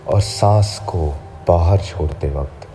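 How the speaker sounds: usual speaking voice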